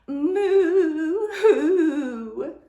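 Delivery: loud, high wailing moo-sob; crying moo. Acted. Miss Moo, rejected by the zoo animals, wails loud and high. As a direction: wail it like a heartbroken cow. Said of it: "and she began to cry".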